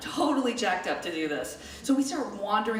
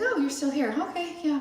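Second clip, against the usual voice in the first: higher-pitched surprised greeting; mild mock surprise; 7 semitones higher than usual. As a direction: imitate someone caught a little off guard. Tired couple greet the waiting kids.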